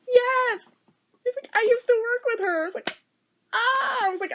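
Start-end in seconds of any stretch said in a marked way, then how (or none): 0.1-0.6 high, loud delighted cry; thrilled yes. Retold or imitated. Coworker guessed the sister's name, thrilled. 1.5-2.6 imitated coworker, high and loud; excited discovery. Coworker realizing he knew the sister, excited. 3.5-4.1 high mock squeal of delight; re-enacted delighted "ah"; excited squeal. Reacting to coworker knowing the sister, excited squeal.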